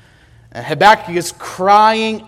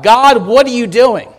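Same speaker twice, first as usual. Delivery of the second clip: pleading shout heavenward, breaking into why me; crying out to God; 4 semitones higher, 4 dB louder than usual. Retold or imitated. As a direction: call out like someone at the end of his rope. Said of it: "you've shouted to God".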